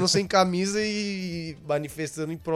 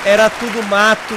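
louder, higher orator flourish; politician speech; 4 semitones higher, 6 dB louder than usual. Acted. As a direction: declaim like a campaigning politician.

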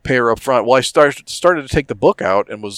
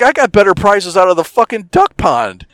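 higher and louder throughout; loud exasperated rant; 9 semitones higher, 5 dB louder than usual. Retold or imitated. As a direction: blow up about the junk prizes. Retold mockery of the prizes.

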